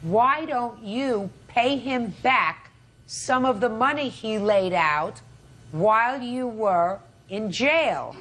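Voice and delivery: each word spaced out and pressed; slow and deliberate. Real, not acted. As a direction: spell the question out slowly. Judge repeating the question slowly.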